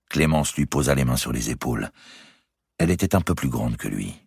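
usual speaking voice

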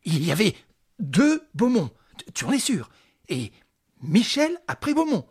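high, loud urgent questioning; agitated excitement. Acted. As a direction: shake the answer out of him. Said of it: "secoua vivement son domestique".

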